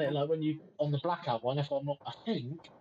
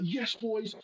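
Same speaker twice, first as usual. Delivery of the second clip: retold cheer; 8 semitones higher, slower than usual.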